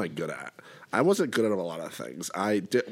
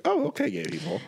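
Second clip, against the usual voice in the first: surprised realization. Retold or imitated. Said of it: "That was when I was like".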